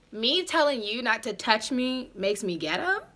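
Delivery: ordinary talk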